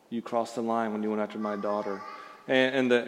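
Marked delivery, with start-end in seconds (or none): 0.1-2.0 a hint of a low, quiet, menacing threat; lightly voiced tough father, quiet and fast; Clint Eastwood. Pastor retelling a film scene, quiet low menace. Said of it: "Eastwood saying".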